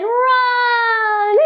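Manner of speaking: high squeaky mouse cry; a tiny mouse shouting run. Pretend. Teacher voices the startled mouse in the rhyme. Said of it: "The mouse said".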